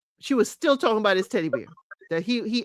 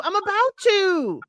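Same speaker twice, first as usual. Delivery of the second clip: higher and slow, stretching about; a higher, lingering I'm about to; mock dragged-out retort; 10 semitones higher than usual. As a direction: drag it out dismissively, like a sulky reply. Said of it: "Stephanie's like".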